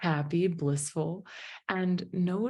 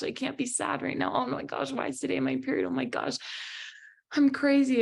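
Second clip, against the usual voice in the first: high-pitched imitation of anxious self-talk; mock fretting; 9 semitones higher than usual. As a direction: act out your panicky inner voice, pitched high. Mimics her own panicky inner story.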